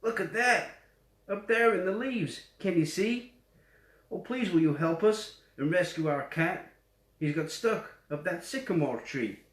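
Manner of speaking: mild story-time yell, pitched well up; lightly voiced kids pointing something out, then pleading; children calling out. A narrator's reading. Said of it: "and they yelled".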